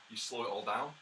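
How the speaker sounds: ordinary delivery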